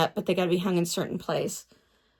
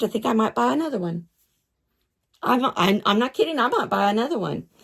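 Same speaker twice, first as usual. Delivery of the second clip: ordinary talk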